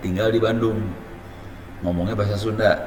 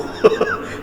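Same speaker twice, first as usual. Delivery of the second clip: a short unvoiced laugh; breathy laugh. Real, not acted. The teacher laughs at his own child's confusion. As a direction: laugh under your breath.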